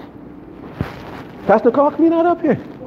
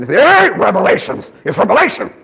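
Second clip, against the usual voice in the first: mock yelled correction, pitched up on the key word; imitated yell; 3 semitones higher than usual. Pastor correcting the preacher years ago, mock yell. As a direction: play the pastor yelling a correction. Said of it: "bald-headed guy yells at you".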